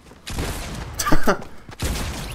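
high, breathy, loud laugh; loud burst of laughter. Real, not acted. Streamer catches the player who felt safe, bursting into laughter. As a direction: burst out laughing at your own trick.

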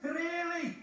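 very high mocking engine voice; high, amused query; chuckling James. A narrator's reading. James chuckling at Gordon, high and teasing. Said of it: "chuckled James".

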